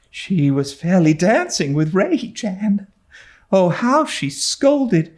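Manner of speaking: put-on high, breathless young girl telling news; a giddy girl half thrilled, half scared; Diana, excited girl. A narrator's reading. Diana to Anne, breathless and high.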